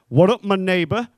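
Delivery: imitated over-confident holler; bold as brass. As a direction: call it out brazenly across a club. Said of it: "bold as brass".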